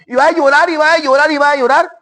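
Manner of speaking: high, repetitive singsong of endless weeping; mock lament. Preacher imitating people who keep crying, mock lament.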